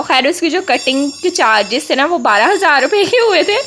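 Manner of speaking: ordinary delivery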